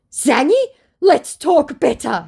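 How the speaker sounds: slow, high, loud coaxing; mock scammer plea. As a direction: ham up his wheedling.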